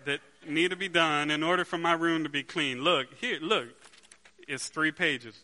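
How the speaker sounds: loud, excited pitch to show off; eager son. Acted. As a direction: wave the list proudly at dad. Son voiced showing chore list.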